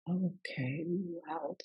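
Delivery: breathy hushed wow; whispered awe. Retold or imitated. Daughter hearing mom's memory, whispered wonder. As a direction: whisper it in amazement. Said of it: "I'm like".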